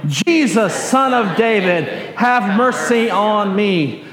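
slow group call with drawn-out ending; leading congregational cry. Shown as a demonstration. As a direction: lead the crowd, stretching the last phrase. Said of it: "One more time".

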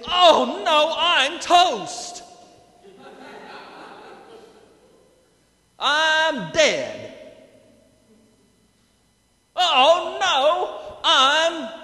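hammed-up terror of a doomed man; mock panic. Isaiah realising he's in God's presence, hammed-up panic. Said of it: "What he really said is".

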